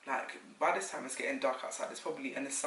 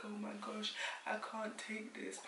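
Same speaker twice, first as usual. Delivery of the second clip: acted overwhelmed plea; 7 semitones higher, 7 dB quieter than usual. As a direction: re-enact giving up under the pain, softly. Retells being overwhelmed by labour pain.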